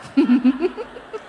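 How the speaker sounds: a high burst of laughter; real laughter from the pulpit; bright laugh. Real, not acted. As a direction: laugh at the joke. Laughing at own story.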